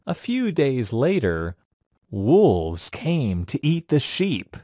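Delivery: usual speaking voice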